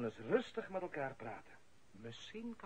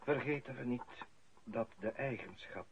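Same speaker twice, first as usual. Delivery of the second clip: solemn eulogy; 4 semitones lower, 5 dB quieter than usual. Acted. Priest at the funeral, a solemn eulogy. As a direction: speak as if over a grave, softly.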